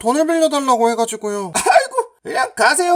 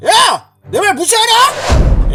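high, loud, drawn-out challenge; angry bully yell; 6 semitones higher, 4 dB louder, much slower than usual. Acted. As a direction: yell at him for ignoring you. Bully yells at ignored demand. (높고 크게 윽박지르는 말투)

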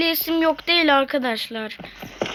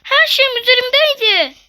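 whining lament; 8 semitones higher, 4 dB louder than usual. Real, not acted. Lost all items, whining.

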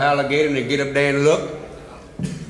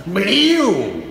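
loud, high mouth gunshot; loud vocal gunshot; gunshot noise; 7 semitones higher, 5 dB louder than usual. Acted. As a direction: bang it out sharp. Gunshot imitated in the story.

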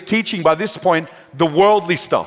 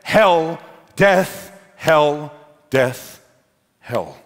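echoing inner words; much slower than usual. Retold or imitated. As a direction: separate beats, sinking away at the end. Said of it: "he heard two words".

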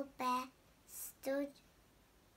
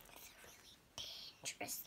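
whisper; 9 dB quieter than usual. Real, not acted.

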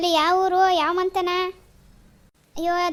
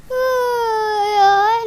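drawn-out groan; 6 semitones higher, 7 dB louder, much slower than usual. Acted. Groan on waking up.

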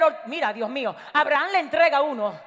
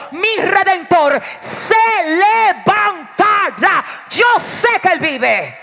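loud, high, slow drawn-out proclamation; full-force defiant preaching; 6 semitones higher, 11 dB louder than usual. Acted. Believers warning the devil their Redeemer lives, full-force defiant preaching. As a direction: hammer out each phrase at full volume.

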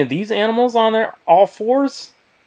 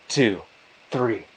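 plain reading voice

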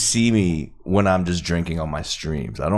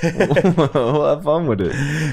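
mocking echo; 7 semitones higher, much slower than usual. Teasing echo of friend's line.

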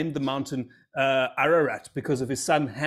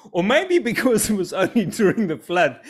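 laughing; 6 semitones higher, 5 dB louder than usual. Real, not acted. Laughs at own joke.

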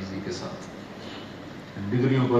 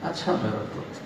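a father too wounded to answer back; pained broken whisper; 3 semitones lower, 9 dB quieter than usual. Retold or imitated. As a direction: whisper it, a helpless father's hurt. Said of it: "جو درد تھا اس جملے میں".

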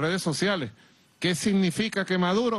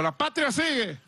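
high, rallying slogan answer; pitched-up mock street cry; called-out reply; 7 semitones higher than usual. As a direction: re-enact calling a slogan back. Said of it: "Y yo le digo".

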